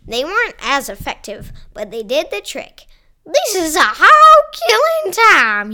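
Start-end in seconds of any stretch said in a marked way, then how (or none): 3.2-5.7 cowboy character voice. Acted. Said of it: "he said".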